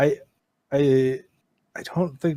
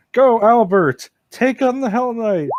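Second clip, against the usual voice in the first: cheering call; 8 semitones higher than usual. Pretend. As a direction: cheer him into battle. Cheering the rabbit into battle.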